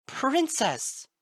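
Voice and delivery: very high, loud shriek. A narrator's reading. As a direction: scream her name. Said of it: "Twilight shrieked".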